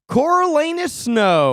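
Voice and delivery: slow, high grand name; dramatic. Pretend. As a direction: announce it grandly.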